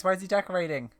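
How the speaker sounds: normal talking voice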